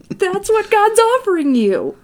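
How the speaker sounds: high, loud sarcastic wonder; hammed-up awestruck sales pitch; mock amazement. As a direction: gush it in a high, put-on voice. Mock sales pitch after gasp.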